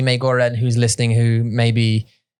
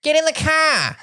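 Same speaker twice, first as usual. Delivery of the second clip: much higher Boston cartoon voice; Family Guy voice; slower than usual. Imitating a Family Guy character for his wife, cartoon Boston voice. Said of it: "It sounds like Family Guy".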